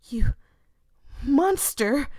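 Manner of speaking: high, loud screamed insult; furious shout. Acted. Voya screaming at the murderer, furious. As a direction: hurl the word at him. Voya's outburst.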